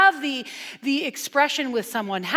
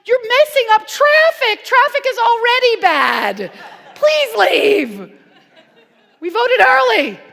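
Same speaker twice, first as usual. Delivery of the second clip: much higher and louder, exasperated mock rant; fed-up locals; 11 semitones higher, 8 dB louder than usual. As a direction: play the annoyed resident shooing politicians away. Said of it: "they're like".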